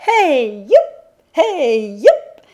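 high shouted hey youp; dance cry. A narrator's reading. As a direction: yelp it out like a folk dance call. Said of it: "On entend un petit cri". (cri de danse joyeux)

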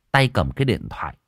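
ordinary delivery

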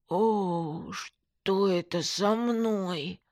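very slow, slurred dismay; long, drawn-out bewilderment at the mirror; dismayed numb-lipped friend. A narrator's reading. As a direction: stretch the words out through a numb lip. Friend sees crooked lip in mirror.

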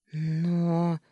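stall, lost for an answer; taken aback. A narrator's reading. Wife caught out by the maid's question, taken aback. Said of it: "опешила я, не зная, что ответить".

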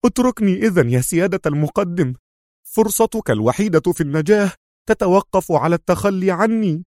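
high, agitated plea; exclaiming. A narrator's reading. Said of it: "هتف به خالد".

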